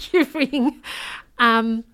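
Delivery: laughing loud repeat; half-laughing you ring. Real, not acted. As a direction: blurt it out through a laugh. Laughs then repeats loudly.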